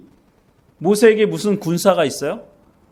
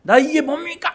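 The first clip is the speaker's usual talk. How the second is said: much higher desperate cry upward; shouting at heaven; 10 semitones higher, slower than usual. Retold or imitated. Moses shouts to the silent sky, high desperate cry. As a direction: cry out to an unanswering God. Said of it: "소리를 질러도".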